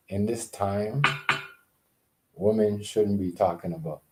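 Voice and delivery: normal talking voice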